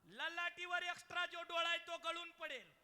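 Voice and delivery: loud, high sermon cry; full-force preaching. Acted. Kirtankar telling the birth story, loud preaching. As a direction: preach it out to the back row. Heavenly voice told loudly.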